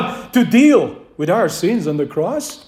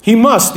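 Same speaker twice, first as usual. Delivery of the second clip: must hit louder and firm; stress on MUST; 3 dB louder than usual. Shown as a demonstration. Preacher showing the congregation where the stress falls on must. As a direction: lean hard on must. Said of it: "The emphasis is always on the word must".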